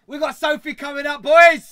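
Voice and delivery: rally the crowd; announcing the next guest, shouting; hype shout. Real, not acted.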